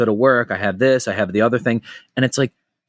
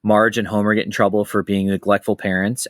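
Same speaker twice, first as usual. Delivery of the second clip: ordinary talk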